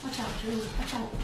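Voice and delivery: very low, soft warning away from the audience; quiet aside. Real, not acted. Parent warning Julie mid-livestream, hushed aside.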